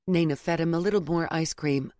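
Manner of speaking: ordinary delivery, nothing marked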